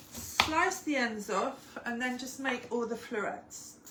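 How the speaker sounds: usual speaking voice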